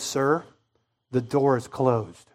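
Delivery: low, soft, slow refusal; quietly final, a hint of an unmoved official; lightly voiced gate agent. Gate agent to late passenger, lightly imitated quiet refusal.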